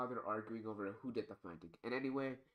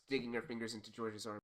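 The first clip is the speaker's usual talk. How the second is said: usual speaking voice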